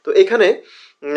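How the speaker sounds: ordinary delivery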